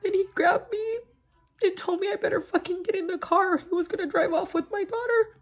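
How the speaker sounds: high, crying voice while recounting; real tearful distress. Real, not acted. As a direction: tell it through tears, pitch held high. Tearfully recalls daughter being taken.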